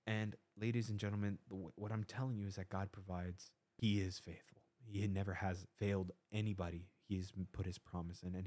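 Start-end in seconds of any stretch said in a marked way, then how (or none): none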